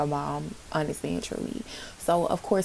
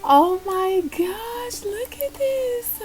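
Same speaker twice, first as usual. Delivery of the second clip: much higher, slow squealing delight; 13 semitones higher, much slower than usual. Real, not acted. Vlogger reacting to the reveal, high squealing delight.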